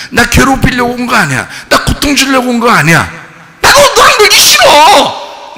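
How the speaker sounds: loud refusal climbing to a high yell; increasingly shrieked defiance; escalating rejection. Acted. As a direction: build to a screamed brush-off. Acted rejection rising to yell.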